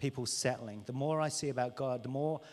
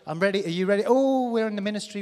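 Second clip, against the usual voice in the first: eager hype; 5 semitones higher, 11 dB louder than usual. Acted. Preacher acting out eager minister.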